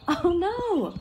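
playful storybook alarm; mock dismay. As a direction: gasp it out for the kids. Reacting to popped button.

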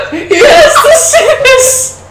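high, slow laughing voice; squealing with laughter. Real, not acted. Laughing excitedly to friend.

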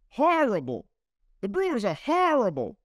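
mock a northerner's emphatic "horrible"; northern accent. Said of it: "one of those guys with the northern accents to say".

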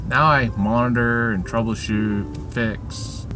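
normal talking voice

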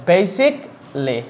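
basically slowly split, "BAY-sik ... lee"; syllable demonstration. Shown as a demonstration. Teacher drilling the syllables, slow "bay-sik, lee". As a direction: break basically before a long "lee". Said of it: "Two syllable, brother".

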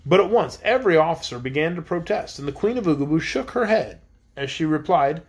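ordinary delivery, nothing marked

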